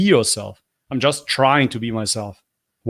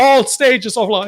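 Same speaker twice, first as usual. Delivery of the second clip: high mocking echo; mocking imitation; 10 semitones higher, 4 dB louder than usual. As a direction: repeat his words in a high teasing voice. Mocking the clip's speaker.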